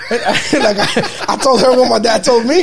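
high laughing words, said through laughter; laughing while talking. Real, not acted. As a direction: laughs out the confession.

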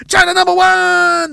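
put-on very high, drawn-out Chinese-accented cheer; mock Chinese cop. Cops drive away boasting, high mock Chinese accent. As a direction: crow it slowly in a caricatured Chinese accent. Cops' triumphant caricatured slogan.

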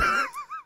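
squealing high laugh; high laughter. Real, not acted. Laughing at his own mock squeals, high laughter.

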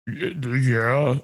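low deep voice; dropping voice deep. Shown as a demonstration. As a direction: push the voice as low as it goes. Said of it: "that's as low as I could talk".

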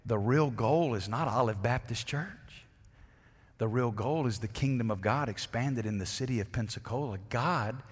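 ordinary talk, nothing marked

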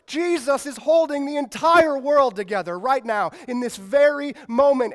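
loud, driving preacher build; preaching at full force. Acted. Preacher firing up the crowd with Thor and Jesus, loud and driving. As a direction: preach it hard, rising to the climax.

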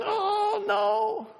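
high, squeaky scared "oh no"; mock-frightened little cry; mock fearful gasp. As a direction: play the timid spouse walking on eggshells. Scared spouse voiced in sermon.

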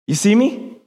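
ordinary talk

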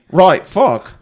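a hint of a British kid swearing; lightly voiced British child; British accent. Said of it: "with a British accent".

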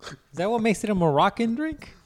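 high, puzzled mock voice; mock confused customer. Mocking a bartender who dips it in, high and puzzled.

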